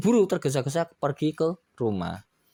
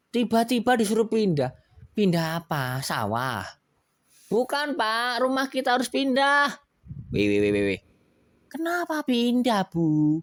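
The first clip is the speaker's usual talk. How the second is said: startled husband; 11 semitones higher than usual. Acted. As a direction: object in disbelief. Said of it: "katanya gitu". (Pak Juli kaget dan protes, suara tinggi)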